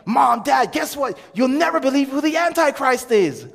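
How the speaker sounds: high, loud burst of big news; hammed-up excited yelling; excited shout. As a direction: call out to your parents, bursting with news. Sons breaking news to parents.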